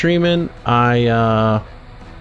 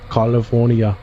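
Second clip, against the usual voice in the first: lightly put-on Austrian accent; quick Austrian-accent imitation of California; Arnold Schwarzenegger. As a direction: say California with a hint of an Austrian accent, broad AH in the first syllable. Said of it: "as Arnold Schwarzenegger would say".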